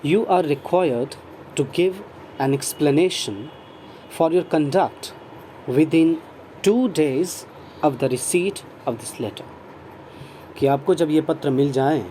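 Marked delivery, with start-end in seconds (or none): 0.0-9.5 very slow, word by word; deliberate classroom dictation. Shown as a demonstration. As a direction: read it out slowly for the class. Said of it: "teacher reads sentence slowly".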